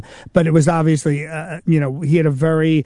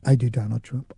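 much lower and softer, almost unheard; 7 semitones lower, 5 dB quieter than usual. Retold or imitated. Admitting to the driver he does Trump, voice dropped low. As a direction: drop the voice low and soft, barely audible. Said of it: "then I lowered my voice and I said".

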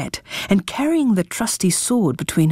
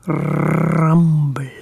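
whispered purr; purring cat; 3 semitones lower than usual. A narrator's reading. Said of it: "purred the Bumble's cat".

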